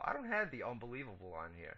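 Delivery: ordinary delivery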